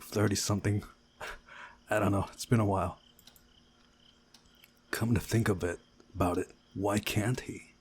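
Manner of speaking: usual speaking voice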